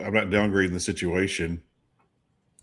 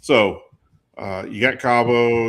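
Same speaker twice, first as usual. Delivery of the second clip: ordinary delivery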